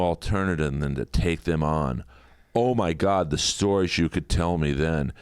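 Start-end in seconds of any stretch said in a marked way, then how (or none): none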